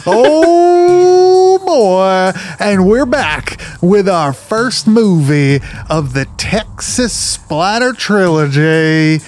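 hammed-up, slow Southern host; Southern accent. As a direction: open the show in a drawn-out Southern accent. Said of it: "keep that accent the whole way through".

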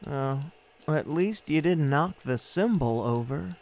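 ordinary talk

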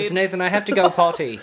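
put-on high, whiny little kid voice; kindergarten kid voice. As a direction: ask like a toddler needing the potty. Kid addressing teacher, mock.

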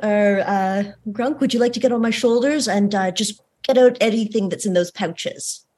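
ordinary delivery

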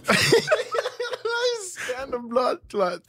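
high squeaky laughter through words; laughing hard. Real, not acted. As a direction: laugh while telling it. Laughing at own story.

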